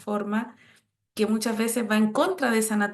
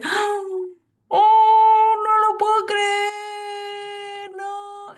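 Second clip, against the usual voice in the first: hammed-up gasp and long, high, loud cry of disbelief; fake surprise; 9 semitones higher, 6 dB louder, much slower than usual. Gift receiver faking surprise at a present, exaggerated. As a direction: overdo the amazement, drawing out every word. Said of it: "Está fingiendo".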